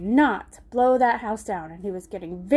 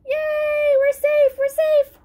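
high, louder happy cheering; cheering pigs; 14 semitones higher, 5 dB louder, slower than usual. Acted. Little pigs celebrating after the wolf runs off. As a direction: celebrate with glee.

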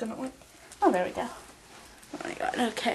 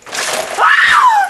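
sudden loud high shriek; startled scream; 16 dB louder than usual. Real, not acted. Something in the room startles her into a shriek.

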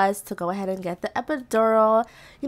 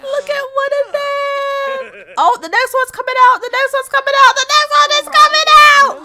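high, loud squeal building louder; excited screaming; 13 semitones higher, 6 dB louder than usual. Real, not acted. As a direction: shriek it, louder with every repeat. Second twin being born.

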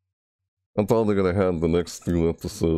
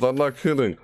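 ordinary talk, nothing marked

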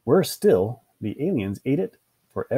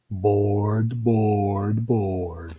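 drawn-out monotone, sinking lower; long, listless repetition; bored; 3 semitones lower, much slower than usual. A narrator's reading. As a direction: drone each word with utter boredom. Narrator acts out the dog's boredom.

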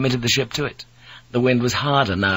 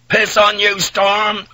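raging shout, high and loud; Blackthorne; 9 semitones higher, 5 dB louder, slower than usual. A narrator's reading. Blackthorne defies the storm from his helpless ship, raging. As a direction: rage at the storm, voice pitched up. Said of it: "Blackthorne raged".

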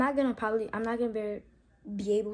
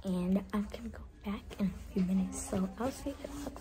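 whispering; 7 dB quieter than usual. Real, not acted. Waiting room before dentist.

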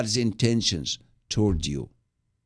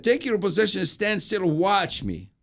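higher, commanding call; a rallying order from God; raised-pitch commanding voice of God; voicing God's command; 9 semitones higher than usual.

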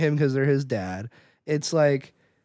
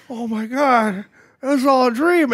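mock amazement; 8 semitones higher, 4 dB louder than usual. Hero waking, acted in the retelling.

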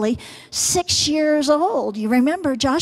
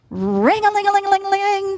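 high, fast sing-song bell ringing; 9 semitones higher, faster than usual. Retold or imitated. Storyteller imitating the race bell for children, high and quick. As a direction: chime it out like a starting bell. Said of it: "that bell rang".

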